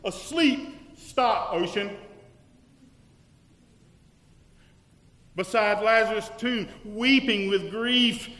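loud, rising sermon climax; full-voiced fervent preaching; preaching at full force. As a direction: drive the truth home to the congregation.

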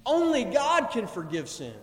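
firm acted protest at full voice; insistent, accusing declaration; scribes' objection. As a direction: state it as an indignant objection. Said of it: "they're standing off to the side and they're saying".

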